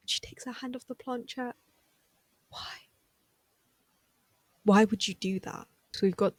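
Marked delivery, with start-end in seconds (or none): none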